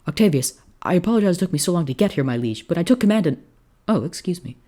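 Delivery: high, fast, chattering apology; eager young officer, hurried. Acted. Newcomer apologizing to his liege, high and hurried. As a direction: rattle it off high, then stop short.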